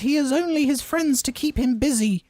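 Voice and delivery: very high squeaky house elf voice; squeaky Dobby voice. Acted. Reading the house elf's line to friends, squeaky high. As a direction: pitch way up into a squeaky elf falsetto. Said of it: "doing the voices and everything".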